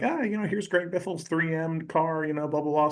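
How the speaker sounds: a hint of a high-voiced show narrator; narrating. New host showing viewers round the garage, mock narrating. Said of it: "So I'm narrating it".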